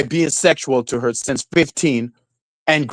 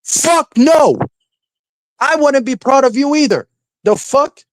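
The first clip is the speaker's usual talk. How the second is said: indignant outburst; 10 semitones higher than usual. Real, not acted. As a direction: blurt it out in disbelief. Indignant retort.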